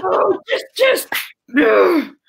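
very high, strained squeal; mock pent-up frustration. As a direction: squeal with exaggerated impatience. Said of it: "sexual tension the whole time".

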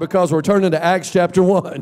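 loud preacher shout. Real, not acted. Pastor shouting to the church as they turn to Acts. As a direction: shout it out to the congregation. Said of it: "and shout".

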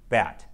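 "bat" ending on a crisp t stop; "bat" ending on a clean t, no th; exaggerated th practice. Shown as a demonstration. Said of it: "more minimal pairs to practice the T H sound".

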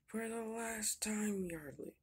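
slow, high, drawn-out rebuke; exasperated scolding. Acted. Fuel collector tells Yardley off for complaining, exasperated.

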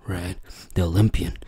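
ordinary talk

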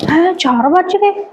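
startled by how late it is; surprised. Acted. Man waking up realizing it is four, surprised.

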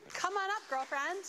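high, loud cheerful call; excited call. Real, not acted. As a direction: call her up with delight. Calling child up excitedly.

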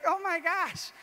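high, loud gushing delight; thrilled. Retold or imitated. Praising Jesus after reading Fulton Sheen, thrilled. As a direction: gush about it in awe. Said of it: "I was like".

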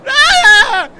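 high, loud scream of fright; real scream of alarm; startled scream. Real, not acted. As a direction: shriek at a sudden jump scare. Jump scare in horror game.

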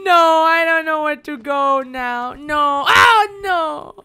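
high, loud fake weeping; hammed-up wailing. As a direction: wail like a lost child. Fake crying, lost.

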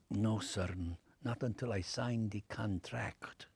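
put-on low, slow, soft Spanish accent; hushed, deliberate Spanish-accented refusal. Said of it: "talk to us without the accent".

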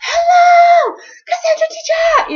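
little students spotting their teacher in the hall, calling out loudly; kids calling out. Retold or imitated. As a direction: yell hello down the hall to your favourite teacher. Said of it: "They're still going".

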